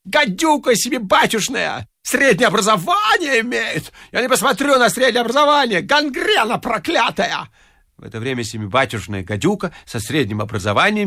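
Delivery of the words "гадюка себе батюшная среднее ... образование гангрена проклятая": high, shrill janitor's yowl, much higher; a drunken janitor bawling at the neighbours; howling janitor. Acted. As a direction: scream it across the whole yard. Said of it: "вопил дворник".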